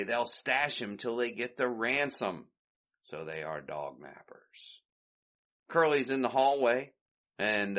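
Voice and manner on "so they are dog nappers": hushed aside, barely voiced; whisper. Real, not acted. Host whispers the twist to listeners, hushed. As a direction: lean in and whisper it like a secret.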